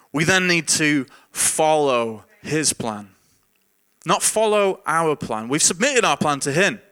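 measured, emphatic sermon delivery; preaching emphasis. Acted. Pastor laying out the second point, emphatically preached. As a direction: drive the point home deliberately.

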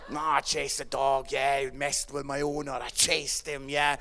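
low, swaggering cat voice; boasting pub cat. Acted. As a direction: brag to mates in a low put-on voice.

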